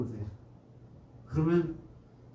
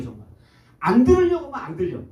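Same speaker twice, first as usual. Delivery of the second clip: ordinary delivery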